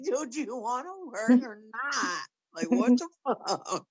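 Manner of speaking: high, laughing disbelief; half-laughing, can't believe this kid; exasperated laughing. Retold or imitated. Aunt cracking up at her nephew's excuses.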